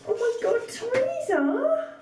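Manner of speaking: high, stretched call of Teresa's name; overjoyed squeal of thanks; excited squeal. Real, not acted. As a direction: squeal with delight. Excited over gift from Teresa.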